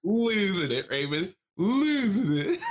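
high, drawn-out wail of disbelief; incredulous exclamation. Real, not acted. Storyteller telling Raymond how she lost it, incredulous.

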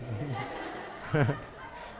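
quiet rising chuckle; amused chuckle after the punchline; soft laugh. Real, not acted. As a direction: laugh gently at the joke. Laughing at own joke.

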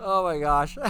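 words breaking into laughter; laughing. Real, not acted. Host cracking up at a chat comment, laughing. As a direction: gasp it out mid-laugh. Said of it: "Reason why I'm laughing".

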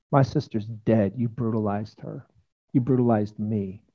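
hushed, heavy confrontation of a tormentor; low, pained accusation. Acted. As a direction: drop low and soft, accusing. Acted victim confronting her sister's tormentor.

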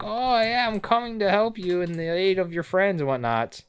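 high put-on heroic voice; imitating Yamcha. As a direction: swoop in high like a cocky cartoon hero. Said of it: "stands right by and is like".

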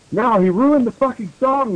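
half-hearted story-reading yell; mock yell. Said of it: "yelled Samara".

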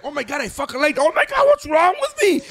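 high-pitched, hammed-up horror at oneself; mock anguish. Admitting he liked the movie, high comic self-horror. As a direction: wail it in comic despair, climbing very high. Acts out horrified self-questioning.

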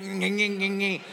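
muffled hummed chewing, mouth full; mock chewing noises. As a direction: savor it with little greedy grunts. Mock eating smuggled chicken.

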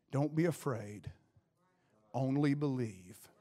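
low, soft, calming voice; steady a frightened father quietly; gentle reassurance. Acted. Jesus to Jairus on hearing bad news, gentle reassurance.

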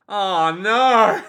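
loud, hammed-up cry of dismay; mock dismay. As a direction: cry out in cartoon horror. Acts character realising the threat.

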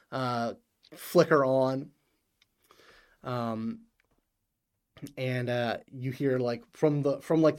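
normal talking voice

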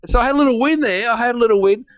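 high, delighted little brag; gleeful triumph. Real, not acted. Traveller telling friend of a customs victory, gleeful triumph. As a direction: lift the pitch way up, pleased as punch. Celebrating getting honey through customs.

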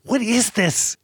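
high, incredulous what is this; baffled. Retold or imitated. Podcast host mocking a confused reaction, incredulous. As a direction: act utterly baffled.

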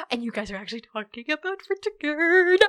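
high, gasping fangirl delight; excited fan. Retold or imitated. History buff hearing Vortigern mentioned, giddily excited.

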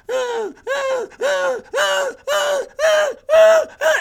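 escalating mimicked puppy whines. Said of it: "he's whining faster".

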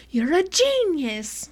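high, drawn-out retold amazement; amazed praise. Fan praising whoever spotted the anagram online, drawn-out amazement. Retells own amazed reaction to discovery.

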